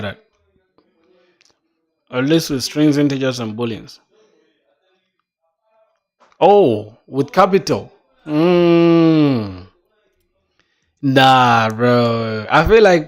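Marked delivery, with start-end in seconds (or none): none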